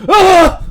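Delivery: sudden loud, high yell; waking yell. Acted. Relic waking as the timer goes off, sudden yell. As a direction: yell out as if jolted from a dream. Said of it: "in a yelling every single time".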